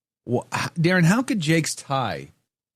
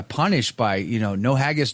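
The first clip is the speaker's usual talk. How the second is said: ordinary talk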